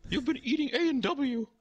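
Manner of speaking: high, manic offended clown; Ronald McDonald. Retold or imitated. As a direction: play an intense, accusing clown up high. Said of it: "And he's like".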